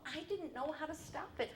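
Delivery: normal talking voice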